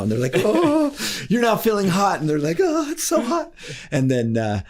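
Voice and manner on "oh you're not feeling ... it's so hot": high, whiny "so hot" with a crying edge; mock overheated whine. Hypnotized family told they feel hot, whiny and high. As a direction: ham up a hypnotized person melting.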